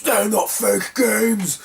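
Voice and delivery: high, very drawn-out protest; mock angry rant. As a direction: imitate an indignant seller, stretching every word. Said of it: "went into a massive rant".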